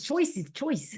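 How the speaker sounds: put-on New Zealand accent, vowels pushed forward; Kiwi accent. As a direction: say it like a Kiwi, light. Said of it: "That, that was my Kiwi accent".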